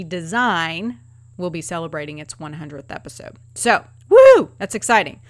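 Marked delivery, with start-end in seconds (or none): 3.5-4.5 high loud woo; excited cheer. Real, not acted. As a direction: cheer it out big. Cheering hundredth episode.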